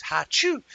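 loud high imitated sneeze; mock sneeze. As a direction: act out the sneeze. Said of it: "I sneezed".

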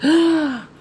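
short, high gasped "ah!"; acted-out thrilled shriek; excited scream. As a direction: let out a sudden high cry of delighted surprise. Acting out her delighted reaction.

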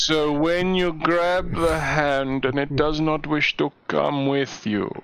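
slow, theatrical villain voice; Bane. Acted. Teasing a friend about grabbing a hand, villain voice. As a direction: intone it slowly, pitch swelling grandly. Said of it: "an approximation of the Bane voice".

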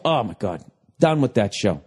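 ordinary delivery

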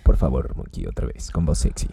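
whispering. Real, not acted. Whispered aside to a friend.

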